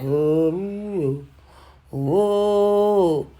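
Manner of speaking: zombie groan. Retold or imitated. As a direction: groan like the walking dead. Walking Dead moan at work.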